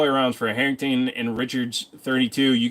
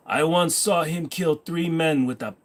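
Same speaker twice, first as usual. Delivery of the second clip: usual speaking voice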